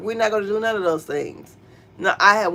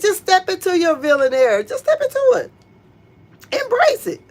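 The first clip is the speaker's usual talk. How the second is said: encouraging urge; 9 semitones higher than usual. Retold or imitated. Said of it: "And I said".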